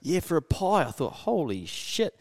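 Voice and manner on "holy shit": slow, higher-pitched astonishment; retold shock. Customer recalling the price of a pie, astonished. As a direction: draw it out, staggered by the price.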